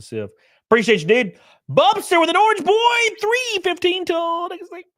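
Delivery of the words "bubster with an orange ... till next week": hype shout. Real, not acted. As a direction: holler it out to the chat like an announcer. Hyped callout to a chatter.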